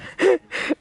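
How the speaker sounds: sudden high squeal of laughter; high laugh burst. Real, not acted. Cackling at a friend's awful Arnie impression.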